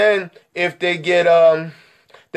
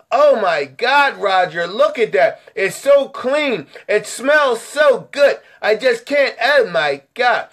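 slow, drawn-out hammed-up gushing; amazed customer; 3 semitones higher than usual. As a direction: swoon over it like a thrilled lady client. Acts delighted customer Miss Johnson.

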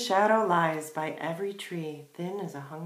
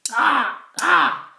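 loud, high crow caws; two piercing caws; crow cawing; 8 semitones higher, 12 dB louder than usual. A narrator's reading. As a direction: squawk like a crow.

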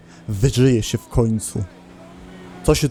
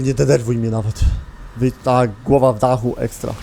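usual speaking voice

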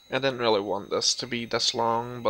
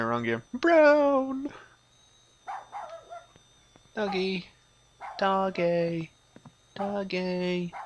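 higher sing-song calling of doggy; 6 semitones higher, slower than usual. Pretend. Player calling the in-game dog, high coaxing voice. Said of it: "that's really hurting my voice box doing that".

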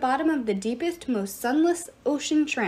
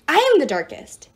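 loud, higher hissed boast; 4 semitones higher, 7 dB louder than usual. A narrator's reading. Iris competing to be the darkest, hissed boast. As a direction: boast it forcefully, a touch of hiss. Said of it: "hissed Iris".

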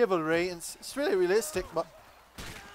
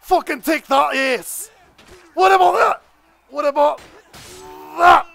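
higher, louder Scottish victory yells; mock Scottish battle cry; triumphant shout; 8 semitones higher, 10 dB louder than usual. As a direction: crow over each kill in a Scottish accent. Scot cheering kills in game.

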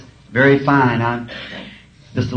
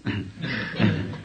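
quiet chuckle; 3 semitones lower, 9 dB quieter than usual. Real, not acted. Laughs at punchline.

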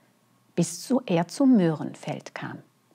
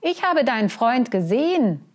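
calling from above; 5 semitones higher, 5 dB louder than usual. Acted. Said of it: "rief eine Stimme über ihm".

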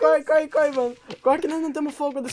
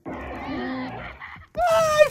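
panicked; 4 dB louder, much slower than usual. Real, not acted. Spiders arrive.

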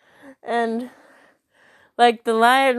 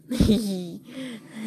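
giggle. Real, not acted. Laugh after teasing.

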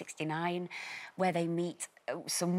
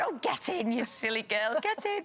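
mum voice; 5 semitones higher than usual. Retold or imitated. Mum urging scared daughter.

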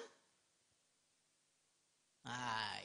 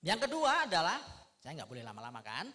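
ordinary talk

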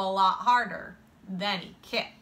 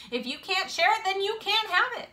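higher-pitched telling-off; firm scolding; 10 semitones higher, faster than usual. A narrator's reading. Boy telling off a friend who won't share, firm and high. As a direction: lay down the rule in a raised pitch.

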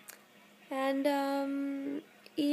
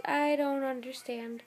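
ordinary delivery

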